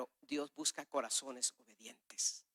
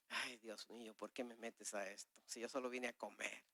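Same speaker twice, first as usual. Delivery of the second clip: much softer, lower grumble to God; soft, lower inner plea asking God why me; hushed lament; 6 semitones lower, 14 dB quieter than usual. Retold or imitated. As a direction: murmur the complaint under your breath.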